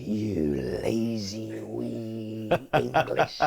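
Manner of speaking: put-on slow, heavy Scottish accent with a laugh running through it. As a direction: imitate the Scottish manager telling off a young player. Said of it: "Sorry about the accent".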